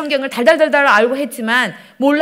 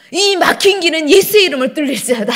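mock-solemn prayer command, raised and loud; 4 semitones higher, 5 dB louder than usual. Stuck driver commanding the blocked road in prayer, retold as loud decree. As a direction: imitate a forceful prayer decree. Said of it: "제가 뭐라고 기도했냐면".

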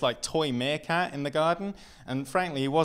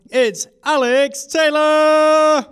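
slow, loud, much higher name call; announcer intro; 14 semitones higher, 15 dB louder, much slower than usual. Acted. Announcer bringing the comedian onstage, drawn-out loud call. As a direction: stretch the name out for the crowd.

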